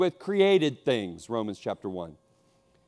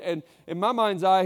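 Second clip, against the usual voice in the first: ordinary talk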